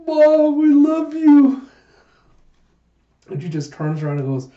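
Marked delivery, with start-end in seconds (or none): 0.1-1.6 very high, loud, drawn-out mock wail; gushing adoration. Pirates declaring love to their captain, gushing hammed-up wail. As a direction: squeal it like overgrown adoring children.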